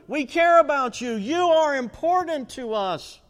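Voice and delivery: pleading that builds to a high, loud desperate cry; from earnest pleading to high, loud begging; pleading disciples. Acted. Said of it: "And they pleaded".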